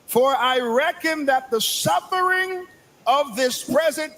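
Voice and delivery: high, building sermon call; rising preacher's cry. Acted. Preacher lifting the congregation with Romans, rising cry. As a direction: preach it up to the rafters.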